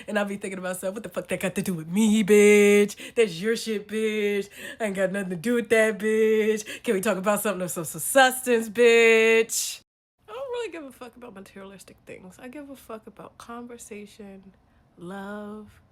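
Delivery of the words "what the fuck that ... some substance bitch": forceful, loud telling-off; loud exasperated retort. Acted. Answering back to men who brag about possessions, loud and exasperated. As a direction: hammer it out loudly, punching each insult.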